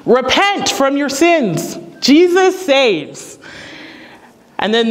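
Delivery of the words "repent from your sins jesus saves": higher, drawn-out proclamation; mock loud public proclamation; imitated street-preacher yell. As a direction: call it out slowly like a street evangelist. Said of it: "began to yell out loudly".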